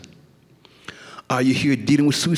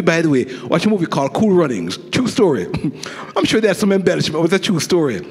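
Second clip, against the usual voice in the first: normal talking voice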